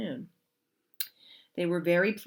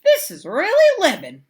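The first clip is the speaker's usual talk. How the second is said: put-on high, loud happy kids; 11 semitones higher, 13 dB louder than usual. Kids delighted at the movies, high and loud. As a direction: revel in it like kids. Kids voiced in read-aloud story.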